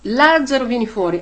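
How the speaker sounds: loud, slowed call with the name up front; commanding call. Retold or imitated. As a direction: call Lazzaro out of the tomb with authority. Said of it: "Gesù ha detto".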